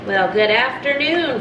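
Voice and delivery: normal talking voice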